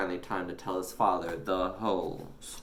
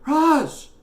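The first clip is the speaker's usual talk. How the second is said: high, loud puzzled question; bemused; 12 dB louder than usual. A narrator's reading. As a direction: ask in bewildered surprise. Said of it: "really quite bemused".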